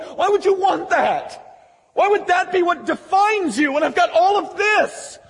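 high, insistent questioning; exasperated pleading. Acted. Father questioning son's self-righteous pride, exasperated plea. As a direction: demand an answer, pitch way up. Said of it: "he begs him".

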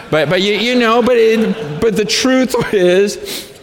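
high, loud preacher's push; fiery preaching. Acted. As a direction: drive the point home to the church. Preaching at full force.

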